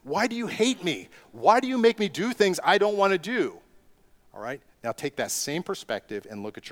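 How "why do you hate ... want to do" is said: high, pleading child's complaint, lightly acted; whiny child. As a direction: play a child protesting vegetables to the parent. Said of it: "they would be like".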